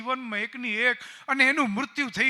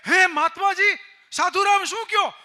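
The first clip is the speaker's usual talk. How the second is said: higher and louder, breathless hope; eager mother; 7 semitones higher, 4 dB louder than usual. Acted. Grieving mother asking the sadhu about her daughter, eager and high. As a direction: she can't believe the news.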